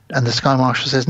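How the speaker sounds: normal talking voice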